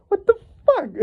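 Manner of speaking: very high, squealed outrage; hysterical disbelief. Acted. Resurrected elf sees what became of his curse, hysterical disbelief. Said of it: "imagine his hysterical reaction".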